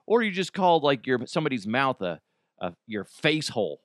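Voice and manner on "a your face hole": ordinary talk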